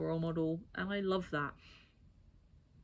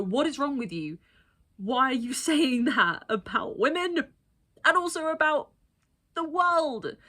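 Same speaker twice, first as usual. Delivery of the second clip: higher, louder indignant telling-off; imagined outraged retort; 6 semitones higher, 9 dB louder than usual. Pretend. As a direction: let rip at him in disbelief. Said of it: "stand up and go".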